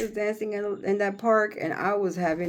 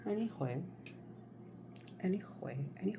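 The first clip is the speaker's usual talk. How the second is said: whispered aside; 15 dB quieter, faster than usual. Real, not acted. Muttered aside to herself.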